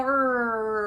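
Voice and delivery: rolled rrrr like a robot engine. Shown as a demonstration. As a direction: growl the r like a machine. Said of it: "like a robot".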